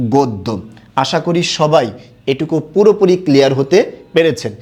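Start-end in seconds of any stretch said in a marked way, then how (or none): 0.1-0.5 গদ্য as "god-do", lower; pronunciation demo. Shown as a demonstration. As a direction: say গদ্য with o and a doubled d. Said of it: "এমন হবে". (গদ্য "গোদ্দো" বলে উচ্চারণ)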